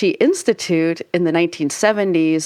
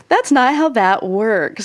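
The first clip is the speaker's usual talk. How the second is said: ordinary talk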